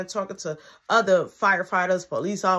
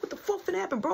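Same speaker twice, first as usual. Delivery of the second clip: higher, pressing question; alarmed question; 10 semitones higher, 7 dB quieter, much faster than usual. Retold or imitated. Narrator confronting his friend, higher and alarmed. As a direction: demand to know what's coming. Demanding what's going on.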